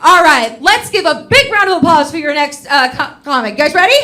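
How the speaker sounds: loud, high introduction building to the name; loud MC intro. Real, not acted. Comic introducing the next comedian to the crowd, a loud, high build. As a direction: bring up the next act with a big call.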